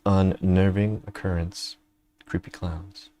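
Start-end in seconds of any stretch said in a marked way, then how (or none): none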